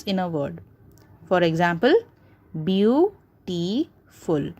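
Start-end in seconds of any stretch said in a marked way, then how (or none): 2.6-4.5 "beautiful" slowly as beau, ti, ful; three separate syllables. Shown as a demonstration. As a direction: break "beautiful" into three beats. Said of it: "three syllables".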